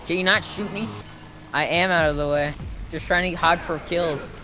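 normal talking voice

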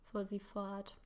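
usual speaking voice

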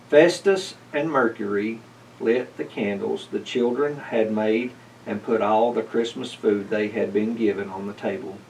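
ordinary delivery

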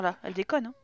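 ordinary delivery

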